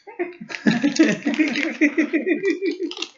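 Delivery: loud, high cackle; real laugh at the pun; high cackling laugh. Real, not acted. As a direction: break into a shrieking laugh. Laughing at own joke.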